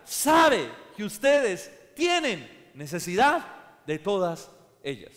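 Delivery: loud, high, slowing shout; preaching shout. Acted. Preacher shouting the contrast to the church, loud. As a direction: drive home the contrast loudly.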